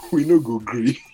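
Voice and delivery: loud Nigerian pidgin chant; pidgin protest shout. Pretend.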